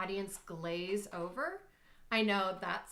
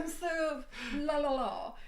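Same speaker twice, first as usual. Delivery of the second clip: mock teacher voice; 6 semitones higher than usual. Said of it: "I always feel like I have to be like".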